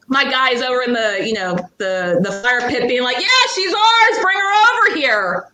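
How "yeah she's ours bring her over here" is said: called out. Retold or imitated. Boyfriend calling from fire pit.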